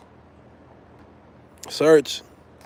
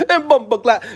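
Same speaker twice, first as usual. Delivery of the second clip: high-pitched Jamaican patois greeting; mock Jamaican accent; 8 semitones higher, 3 dB louder than usual. Vlogger back native from Jamaica, mock Jamaican accent. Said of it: "Come back native".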